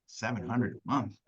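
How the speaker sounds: soft, judging "Ooh" at the price; judgmental ooh. Shown as a demonstration. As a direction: sound quietly shocked by their bill. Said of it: "Be careful with how you sound".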